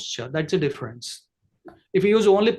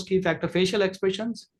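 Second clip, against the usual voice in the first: ordinary talk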